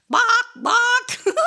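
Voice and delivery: high, loud clucking bawk with a laugh; chicken noise. Pretend. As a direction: squawk it like a hen for the kids. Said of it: "And what do chickens say".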